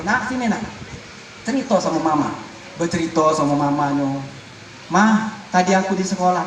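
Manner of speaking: low, gentle invitation to share; gentle mother. Retold or imitated. Mother warmly asking her child about school, gentle and low. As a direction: coax the child softly to open up.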